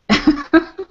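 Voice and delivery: short high burst of laughter; a quick bright laugh. Real, not acted. Laughs at her own joke.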